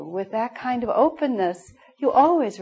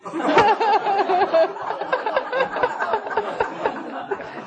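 real laugh, pitched up; long high laughter; 11 semitones higher than usual. Real, not acted. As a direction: let the laugh roll out. Laughs at her own punchline.

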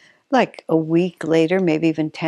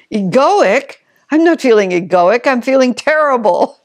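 higher and louder, indignant retort; insulted protest; 6 semitones higher, 7 dB louder than usual. Retold or imitated. Friend retorting to Swami, insulted, higher and louder. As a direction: bristle at the word like you've been slapped. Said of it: "had enough presence of mind to feel insulted".

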